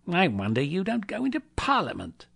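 high, mocking mock-admiration; sarcastic. A narrator's reading. Scrooge ridiculing his nephew's speech, sarcastic. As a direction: pitch it up with scorn.

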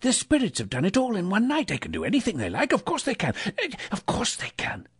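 high, breathless relief; giddy reassurance to himself; elated self-talk. A narrator's reading. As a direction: marvel to yourself, pitched high. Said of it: "said Scrooge to himself".

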